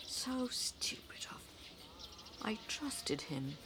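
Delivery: low, soft, hurt; bitter disappointment. Acted. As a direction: quietly admit being fooled. Aunt deceived, softly.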